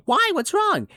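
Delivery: high-pitched mock concern; concerned people. Mimicking people who ask what's wrong, high mock concern. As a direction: imitate fussing, worried askers. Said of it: "then people say".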